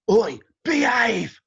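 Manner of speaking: slow, loud "Oi, behave!" like a stern rock elder; gruff shout from [name]. Retold or imitated. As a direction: bark it out slowly, telling the kids off. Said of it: "we hear a voice".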